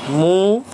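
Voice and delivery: मू stretched long and slow on the long ऊ; drawn-out vowel. Shown as a demonstration. Teacher showing the long ऊ matra, stretched slowly. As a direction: hold the ऊ in मू to show the matra. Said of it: "मूली".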